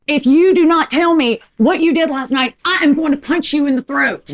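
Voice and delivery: high, loud, emphatic warning; angry threat. Acted. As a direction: lay down a furious ultimatum. Rachel threatens Dale in a quarrel.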